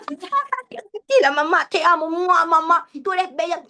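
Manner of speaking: high, slow, sweet mock voice of a child in love; lovestruck son. Mother imitating her lovestruck son shrugging off a scolding. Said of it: "Y ellos".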